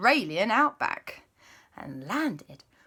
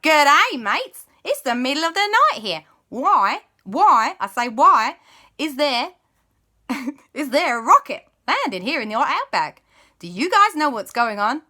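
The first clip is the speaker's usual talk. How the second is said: put-on loud, higher Australian accent; Australian guide; 8 semitones higher, 8 dB louder than usual. Australian guide woken at night, loud Aussie accent. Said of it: "their Australian guide was like".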